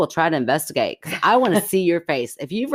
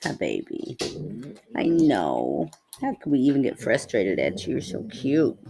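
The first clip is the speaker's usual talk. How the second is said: cooing at the dog; 3 semitones lower, 4 dB quieter than usual. Real, not acted. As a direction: coo at a beloved dog.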